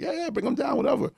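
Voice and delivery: high-pitched, offhand imitation of a cool friend; easygoing yes. Chad agreeing to let a friend bring someone along, imitated as easygoing. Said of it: "And Chad's always cool".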